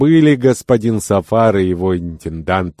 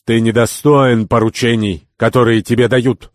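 loud, higher rebuke; heated, raised-voice reproach; flaring anger; 4 semitones higher, 6 dB louder than usual. A narrator's reading. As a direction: lose your temper at a subordinate. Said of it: "вспылил Сафар, не в силах сдержать гнев".

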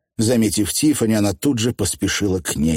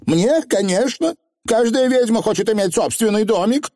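very high, emphatic witch; haughty, high-pitched certainty; self-important young witch. Acted. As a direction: declare it grandly in a high voice.

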